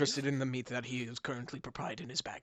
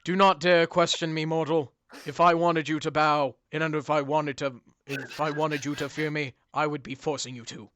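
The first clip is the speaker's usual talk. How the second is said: booming demon lord; 3 dB louder than usual. Acted. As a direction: speak as an all-powerful being. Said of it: "you hear him say".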